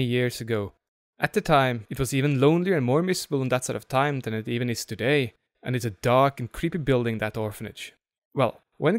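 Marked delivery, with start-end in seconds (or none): none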